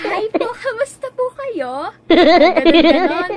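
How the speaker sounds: loud laugh, slow; cracking up at herself. Real, not acted. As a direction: burst out laughing. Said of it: "kailangan bibo ka".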